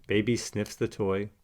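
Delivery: ordinary delivery, nothing marked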